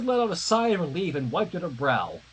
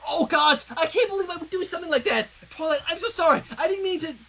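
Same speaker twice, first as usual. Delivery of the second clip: much higher, fast, panicked sorry; horrified apology; 10 semitones higher, faster than usual. Acted. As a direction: gasp it out in horror. Twilight horrified after slapping.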